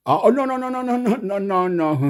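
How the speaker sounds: high, loud frantic string of no; hammed-up panic at the angry uncle; panicked backpedal. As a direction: scramble to take it back in mock terror. Panicked backpedal after insulting uncle.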